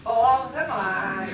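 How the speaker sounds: slow, loud drawn-out oh come on; fed-up groan to the heavens; exasperated plea. Shown as a demonstration. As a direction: plead with the sky, exasperated. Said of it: "you gotta whisper in the air, Oh, come on".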